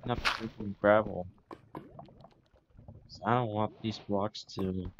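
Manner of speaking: ordinary talk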